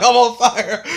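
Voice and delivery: loud, high yell breaking into laughter; hammed-up cry of alarm; mock panicked shout. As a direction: imitate the burning kid, laughing. Acts burning boy's panicked yells.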